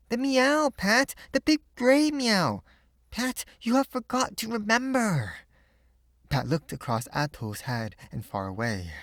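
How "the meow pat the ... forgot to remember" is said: put-on little girl Pan. A narrator's reading. Pan's voice.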